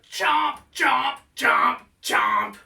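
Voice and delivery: loud, snapping chomps like big jaws; loud chomping. Shown as a demonstration. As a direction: bite each chomp out loud. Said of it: "Make some big jaws".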